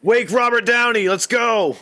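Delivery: high-pitched rallying yell; mock urgent shout. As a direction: call it out as a mock emergency. Mock urgent demand for sequel.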